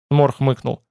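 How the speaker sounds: ordinary delivery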